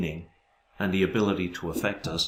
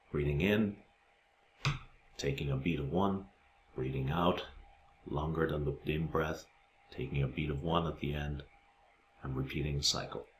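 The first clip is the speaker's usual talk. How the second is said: guided meditation; 4 semitones lower, 6 dB quieter than usual. Acted. Guiding listeners through the six breaths exercise, soft meditation voice. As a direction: talk them softly through the breath.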